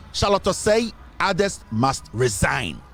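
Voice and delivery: slow, deliberate quoting of the demand; bold statement. Retold or imitated. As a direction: spell out the demand word by word. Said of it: "make a bold statement".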